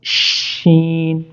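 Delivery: शीन slow and long; drawn-out letter. Shown as a demonstration. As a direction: stretch शीन out. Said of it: "इस तरह आप पढ़ें". (शीन को लंबा खींचें)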